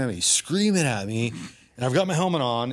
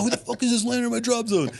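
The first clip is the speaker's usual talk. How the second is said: higher, fast indignant outburst; freaked-out rant; 12 semitones higher, faster than usual. Retold or imitated. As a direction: rattle it off in outrage. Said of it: "freaking out".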